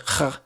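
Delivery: throaty Scouse "kh" sound; throaty rasp. Shown as a demonstration. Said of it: "it's like a throaty thing".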